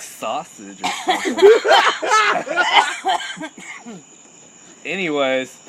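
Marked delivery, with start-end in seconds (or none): 1.4-4.0 long high giggle; high laugh. Real, not acted. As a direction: giggle hard. Laugh after joke.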